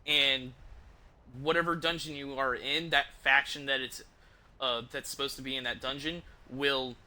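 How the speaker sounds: ordinary talk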